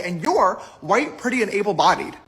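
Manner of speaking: ordinary talk, nothing marked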